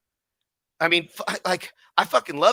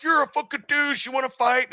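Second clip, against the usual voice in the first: playing a mean commenter picking a fight; mock aggressive bro; 10 semitones higher than usual. Owner voicing an imagined rude commenter, mock high aggressive challenge.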